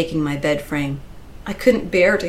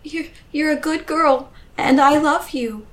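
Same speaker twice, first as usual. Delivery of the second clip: halting, high, choked-up love; crying reassurance to a child; tearful; 10 semitones higher, 3 dB louder than usual. A narrator's reading. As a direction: stammer comfort through tears. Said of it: "Tears had begun to streak my face".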